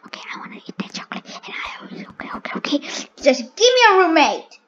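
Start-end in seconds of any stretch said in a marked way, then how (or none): 0.1-2.5 quick hushed whisper; fast secret whisper. Real, not acted. Whispering while playing.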